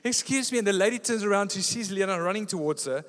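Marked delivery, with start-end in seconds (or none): 0.0-0.6 loud, high repeated call. Retold or imitated. Wife calling a second time after the walking lady. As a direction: call again to catch her attention. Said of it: "And Eliana starts calling".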